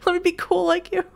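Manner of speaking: high, fangirling plea; mock gushing. Player begging Elton to show them how, high mock gushing. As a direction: gush in a high voice, breaking into laughter.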